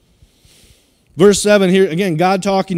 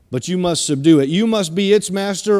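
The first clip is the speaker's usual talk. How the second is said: usual speaking voice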